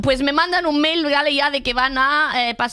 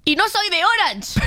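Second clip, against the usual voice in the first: higher-pitched incredulous punchline; 5 semitones higher than usual. Real, not acted. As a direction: land it as an absurd revelation. Punchline of billing mishap, incredulous. (incrédula, con tono más agudo)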